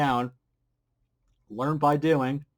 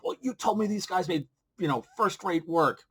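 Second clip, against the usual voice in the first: higher-pitched, mildly indignant complaint; mock pissed off; 6 semitones higher than usual. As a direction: imitate a disgruntled person griping. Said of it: "then they're gonna be pissed off".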